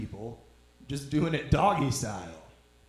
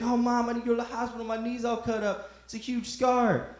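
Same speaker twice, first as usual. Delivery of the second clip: put-on high-pitched kid complaining to mom; whiny child; 7 semitones higher than usual. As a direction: play a hurt kid pleading with Mom. Child whining over skinned knees.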